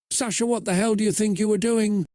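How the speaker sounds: quick, high-pitched, mildly angry demand; angry questioning. A narrator's reading. Jade confronting Sasha privately in the basement, mildly angry. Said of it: "Jade questioned angrily".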